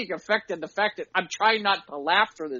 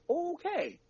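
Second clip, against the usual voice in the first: high resigned okay; 9 semitones higher, 10 dB quieter than usual. Retold or imitated. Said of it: "I literally say it, like".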